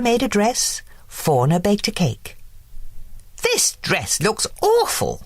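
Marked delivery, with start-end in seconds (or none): 3.5-5.1 lightly voiced fussy fairy, a bit higher; a hint of a picky fairy; Merryweather declaring. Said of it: "declared Merryweather".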